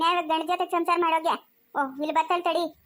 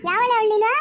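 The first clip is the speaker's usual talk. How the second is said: louder, higher confrontational yell; angry challenge; 4 semitones higher, 5 dB louder than usual. Acted. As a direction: call her out for a fight. Angry challenge at neighbour's door. (ಜಗಳಕ್ಕೆ ಕರೆಯುವ ಕೂಗು)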